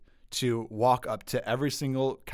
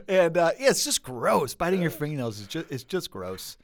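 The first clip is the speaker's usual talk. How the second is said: ordinary talk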